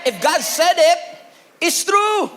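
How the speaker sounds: high, loud proclamation: it's TRUE; loud preacher's cry; preaching shout. Real, not acted. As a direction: declare it at full voice.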